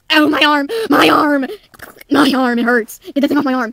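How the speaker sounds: loud cries of hurt, easing slightly; acted yelps of pain; pained yelling. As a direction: cry out as if your arm hurts. Character's arm is taken away.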